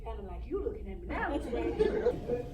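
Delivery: very soft, low, dazed recollection; subdued. Acted. Girl telling Miss Wade about waking at home, subdued. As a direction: speak low, as if just coming round. Recalls waking after fainting.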